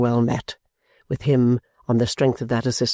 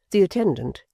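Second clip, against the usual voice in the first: plain reading voice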